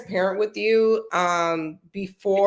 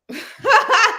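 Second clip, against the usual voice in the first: a high, loud burst of laughter; awkward laugh; 6 dB louder than usual. Real, not acted. Laughing at her own admission about fearing hell. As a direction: laugh it off, a bit embarrassed.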